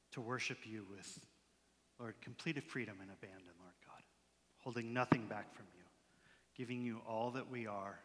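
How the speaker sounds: low, very soft prayer with pauses; quiet prayer. Real, not acted. Pastor asking God to teach them worship, hushed prayer. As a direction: pray in a hush, dropping almost to a whisper.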